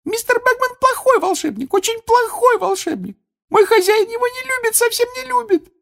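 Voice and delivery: angry, very high elf squeak; indignant squeaky house-elf; cross Winky. A narrator's reading. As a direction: denounce the wizard in a loud tiny voice. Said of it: "рассердилась".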